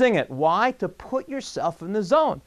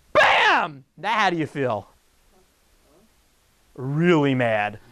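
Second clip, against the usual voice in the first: usual speaking voice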